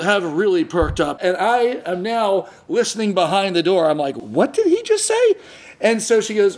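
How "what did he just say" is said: high, far-off voice, stunned; can't believe what he just heard; incredulous. Retold or imitated. Husband overhearing the owner behind the door, incredulous.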